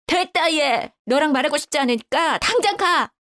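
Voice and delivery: high-pitched huffy mother-in-law; acted fed-up outburst; angry dismissal. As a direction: order her out in a huff. Mother-in-law orders her out.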